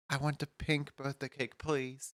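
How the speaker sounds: put-on soft, small voice of a shy child; a hint of a polite little kid; little kid's voice. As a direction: ask quietly like a little boy. Kid ordering a cake.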